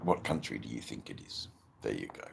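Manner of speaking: ordinary delivery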